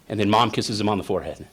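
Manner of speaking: ordinary talk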